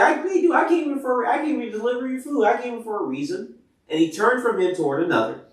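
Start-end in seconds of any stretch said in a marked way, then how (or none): 0.0-3.5 high-pitched, indignant self-defence; pleading his case, pitched well up; defensive protest. Retold or imitated. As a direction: act out a kid brother protesting his innocence. Acting out David protesting to brother.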